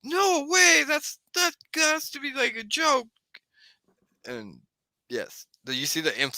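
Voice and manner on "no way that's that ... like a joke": very high-pitched, incredulous exclamation; disbelieving onlooker. Acted. Game master acts out bystanders refusing to believe it, amazed. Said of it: "And they're like".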